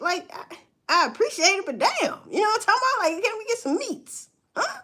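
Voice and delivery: high, pleading half-laughing complaint; exasperated plea. Real, not acted. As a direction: beg for better food, fed up. Venting about pantry food.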